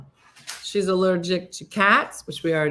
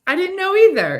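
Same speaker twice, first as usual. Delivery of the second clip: high and loud; bursting with amusement; excited; 12 semitones higher, 8 dB louder than usual. Real, not acted. Laughing along with the joke.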